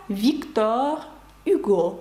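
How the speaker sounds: "Віктор Гюго" as veek-TOR ü-GO, no initial H; French pronunciation. Shown as a demonstration. Teacher reading the name in French, silent H, hard G. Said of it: "французькою він пишеться ось так".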